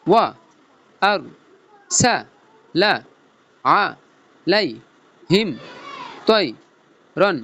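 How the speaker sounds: single syllables with a pause after each. Shown as a demonstration. Teacher splitting the line for pupils, syllable by syllable. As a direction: break the verse into separate sounds. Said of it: "ভাঙ্গা ভাঙ্গা সুরে বলবো".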